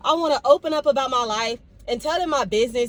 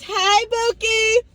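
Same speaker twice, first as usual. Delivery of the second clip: drawn-out cheerful greeting; 11 semitones higher, 6 dB louder, much slower than usual. Real, not acted. Greeting coworker on camera.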